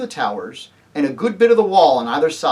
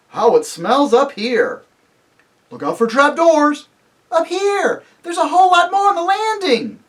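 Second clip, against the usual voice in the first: high, raised searchers' calls; excited crying out; 12 semitones higher, 6 dB louder than usual. Acted. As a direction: call out discoveries to the others. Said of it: "everyone's voices crying out at once".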